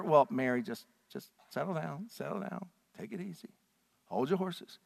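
soft, calming hush to a grieving woman; soothing. Pretend. Jesus soothing Mary, soft and calming. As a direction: gently settle her down. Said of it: "Does he say to her".

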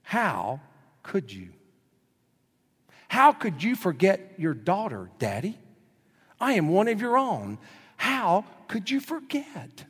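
lightly voiced little girl, reproachful; a child asking Daddy why he left her; mild mock hurt, rising high at the end; hurt daughter. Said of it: "Here's what she said to him".

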